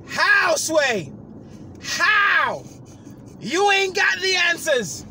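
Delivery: very loud, very high, slow yell; furious yell. Retold or imitated. Yelling at the agent on the phone, furious. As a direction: scream the question at them.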